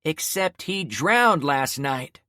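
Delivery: screaming. Acted. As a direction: break into a scream. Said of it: "before screaming".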